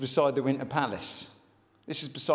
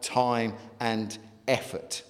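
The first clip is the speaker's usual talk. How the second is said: God speaking tenderly; 5 semitones lower than usual. Retold or imitated. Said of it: "And God says".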